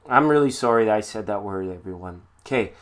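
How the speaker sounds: ordinary delivery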